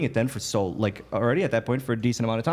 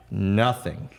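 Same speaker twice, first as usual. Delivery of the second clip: ordinary talk, nothing marked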